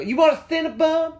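very high, loud mock mom shriek; mom impression. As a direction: mimic the mom in a hammed-up high voice. Imitates the mom again, mockingly.

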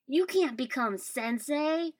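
loud, high, drawn-out melodrama; horrified protest. A narrator's reading. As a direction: protest loudly as if appalled. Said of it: "sounding absolutely horrified".

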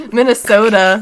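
Minnesota belted out loud and clear, the s sharp; loud playful correction. Real, not acted. Host correcting herself to her cohost, shouting Minnesota playfully. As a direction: shout Minnesota out to get it right. Stumbles, then repeats the state name.

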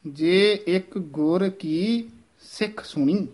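slow, ਗੁਰ and ਕੀ kept apart; never ਗੁਰਕੀ; careful ਗੁਰ ਕੀ ਸਿੱਖ ਸੁਣੀ; separated ਗੁਰ ਕੀ. Shown as a demonstration. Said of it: "ਗੁਰ ਕੀ ਨਹੀਂ ਕਹਿਣਾ ਹੈ".

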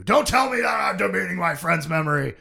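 loud, high-pitched outraged yell; hammed-up indignant outburst; acted mad. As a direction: blow up as the offended friend. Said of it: "He starts getting mad".

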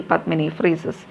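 ordinary delivery

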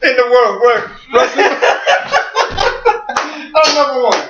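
high, shouting wrestler boast; barbarian. Acted. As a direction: roar it like a barbarian champ. Wrestler flexing legs promo.

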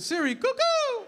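high, drawn-out "cuckoo" hoot; street call. Retold or imitated. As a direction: hail the phone with a bird-like hoot. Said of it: "I said".

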